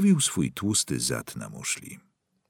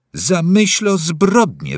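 loud, slow cry on the edge of tears; near-tearful exclamation; 11 semitones higher, 9 dB louder, slower than usual. A narrator's reading. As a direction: burst it out, almost breaking down. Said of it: "wykrzyknął Parsons, o mało nie wybuchając płaczem". (okrzyk bliski płaczu)

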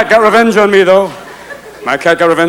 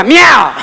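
loud, high cat meow; 6 semitones higher, 4 dB louder than usual. Acted. Comic imitating a cat on stage, loud meow.

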